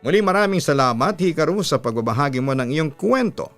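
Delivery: usual speaking voice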